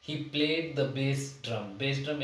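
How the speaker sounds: slow, word-by-word dictation of the sentence; slow example reading. Shown as a demonstration. Teacher reads the example sentence slowly to students. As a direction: read it out slowly for the class to follow. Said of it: "reads example sentence slowly".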